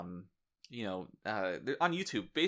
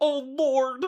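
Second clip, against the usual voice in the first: much higher, louder cartoon yelp; Goofy shout; 9 dB louder than usual. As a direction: yell it like a goofy cartoon dog. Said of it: "he shouts out like Goofy from the Disney".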